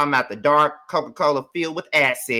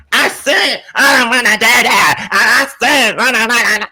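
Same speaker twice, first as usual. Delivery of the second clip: much louder, higher whiny mock shouting; mocking tantrum; 8 semitones higher, 9 dB louder than usual. Imitating Karma jumping up at the party, loud and higher mock shout. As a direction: hammer it out like a grown woman's tantrum.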